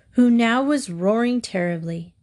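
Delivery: ordinary delivery, nothing marked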